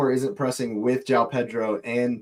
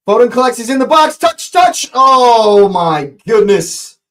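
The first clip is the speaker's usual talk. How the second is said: higher and louder with each touch; rising commentary ending in a slow drawn-out oh; excited build to a gasp; building excitement; 9 semitones higher, 8 dB louder than usual. Real, not acted. Attack builds into the box.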